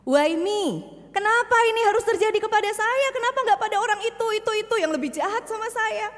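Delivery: high, fast, loud despairing questions; anguished why me. Acted. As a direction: plead with heaven in disbelief. Preacher voices despairing believer. (protes putus asa yang keras dan cepat)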